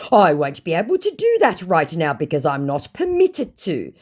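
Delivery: mock a scammer protesting; put-on officious refusal. First take, scammer's reply.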